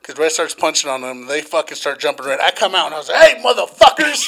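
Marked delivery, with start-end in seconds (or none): 3.2-4.3 high, loud call-out; re-enacted challenge shout. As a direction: yell at a gang to back off. Re-enacting calling out to attackers.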